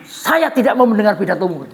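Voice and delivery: loud, bold protest; defiant refusal. Acted. Salman defies Umar in public, loud and bold. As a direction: throw it back at the caliph.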